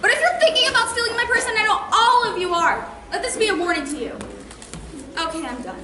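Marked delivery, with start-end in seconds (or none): 0.0-4.2 higher, raised defiant voice; defiant warning. Acted. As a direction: bounce back into threatening the room. Renewed threat to the group.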